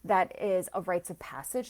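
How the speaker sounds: usual speaking voice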